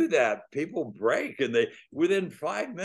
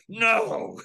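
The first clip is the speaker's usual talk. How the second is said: sharp refusal, higher and louder; curt angry no; 7 semitones higher, 4 dB louder than usual. Retold or imitated. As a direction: shut the question down hard. Angry cabbie refuses again.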